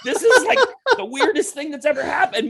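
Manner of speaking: can't believe what's happening; baffled disbelief. Retold or imitated. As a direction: uncle bewildered by the fight, high and incredulous. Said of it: "'cause he was like".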